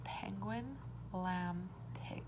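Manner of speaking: whispered word list. Shown as a demonstration. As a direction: whisper the words softly to the class. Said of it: "Which one is different?".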